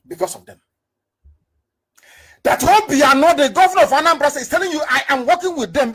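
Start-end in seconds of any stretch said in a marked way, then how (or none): 2.4-5.9 high, loud, forceful rant; indignant accusation. Real, not acted. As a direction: call the governor out with a raised voice. Indignant accusation against the governor.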